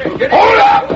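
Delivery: full-throated high shout to halt the posse. Acted. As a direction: yell it out to stop them. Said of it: "Harkness shouted".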